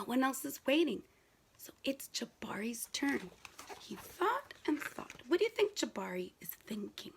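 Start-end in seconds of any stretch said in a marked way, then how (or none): 1.6-7.1 suspense whisper. Real, not acted.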